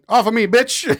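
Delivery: half-hammed defiant shout; tough kid fighting shark. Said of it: "he was literally like".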